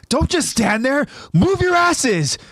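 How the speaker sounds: high-pitched urgent order, drawn out at the end; acted shout in pursuit; yelling out. A narrator's reading. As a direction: yell to get them moving. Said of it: "Jean in pursuit yells out".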